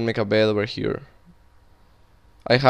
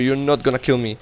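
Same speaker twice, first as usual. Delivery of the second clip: normal talking voice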